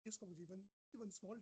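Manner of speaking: barely audible, far from the mic; faint voice, almost lost; too quiet. Real, not acted. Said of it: "Prabhu, your voice is very low".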